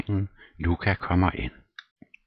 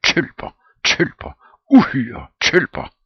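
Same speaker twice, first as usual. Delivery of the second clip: loud, rising, spat-out insults; raging name-calling; furious insults; 10 semitones higher, 10 dB louder, much slower than usual. Acted. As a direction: stamp and hurl the words. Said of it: "knytter næven og stamper i gulvet".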